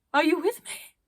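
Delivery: calling out. Acted. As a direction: call into the whiteout. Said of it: "she called to the group again".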